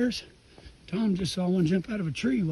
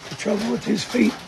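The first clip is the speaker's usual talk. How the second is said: loud; 2 semitones higher, 7 dB louder than usual. Real, not acted. Talking over rain noise.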